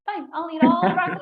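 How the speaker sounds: put-on high, loud, quick stubborn kid; a hammed-up defiant kid shouting back at her parents; defiant child. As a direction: fling it back like a contrary little girl. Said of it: "And I was like".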